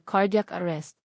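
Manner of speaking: ordinary delivery, nothing marked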